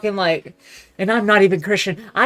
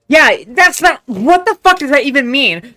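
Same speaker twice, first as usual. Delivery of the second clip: louder and higher, exasperated; loud outrage; 4 semitones higher, 6 dB louder than usual. Real, not acted. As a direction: blast the question in disbelief. Ranting at a song lyric.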